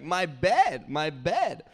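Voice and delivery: imitating a child's gleeful cry; excited toddler. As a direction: chant it like a bouncing toddler. Voicing toddler jumping on bed.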